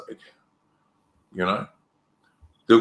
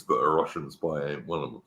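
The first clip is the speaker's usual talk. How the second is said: usual speaking voice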